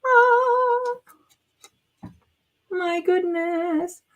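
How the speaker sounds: high squealed "oh", then slow wonder; real high-pitched delight; delighted awe. Real, not acted. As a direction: draw it out high in amazement. Delighted reaction to finished reveal.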